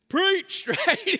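high, loud burst of laughter on the word; laughing excitement. Real, not acted. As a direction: laugh it out, delighted. Laughing at Peter's habit.

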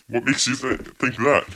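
ordinary talk, nothing marked

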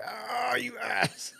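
high sudden yelp of surprise; startled yelp. Real, not acted. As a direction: yelp as if stepped on. Cat stepping on him.

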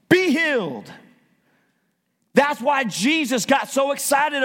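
fired-up sermon voice; impassioned preaching. Real, not acted. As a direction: preach it with force, punching key words. Preaches at full force.

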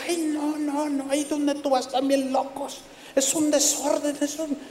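lightly voiced gossip mocking the church; a hint of a scornful critic. A critic gossiping about the noisy church, high and scornful. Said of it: "usted se va a encontrar con gente".

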